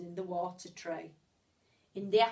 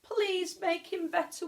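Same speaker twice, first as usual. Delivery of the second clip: pleading cry; 8 semitones higher than usual. A narrator's reading. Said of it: "we cried".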